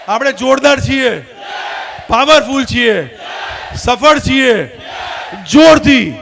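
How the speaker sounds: loud, punched call-and-response chant; full-power affirmations. Real, not acted. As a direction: shout each one for the crowd to echo. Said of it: "જોરથી બોલજો, જેટલી તાકાત હોય એટલી".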